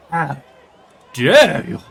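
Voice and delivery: loud, indignant "how dare you"; mock outrage. King learning of a faction against him, loud outrage. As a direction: bellow it at the traitors.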